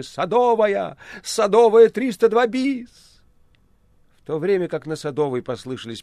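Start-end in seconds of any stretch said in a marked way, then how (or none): 0.0-3.2 high, loud urgent shouting. Acted. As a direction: yell the address into a phone. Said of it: "стали кричать в телефоны".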